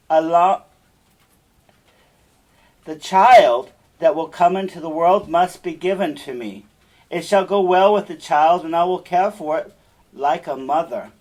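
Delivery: commanding witch. Acted. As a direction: lay down her terms with force. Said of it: "Then the witch said".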